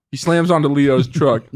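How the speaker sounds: ordinary talk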